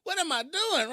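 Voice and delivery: high, loud, incredulous "what am I doing"; bewildered. Retold or imitated. Laughing at herself to the viewers, high bewildered outcry. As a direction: throw up your hands at yourself.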